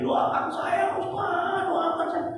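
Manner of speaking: retold yelling. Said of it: "Dia teriak-teriak di luar".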